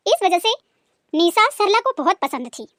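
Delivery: ordinary talk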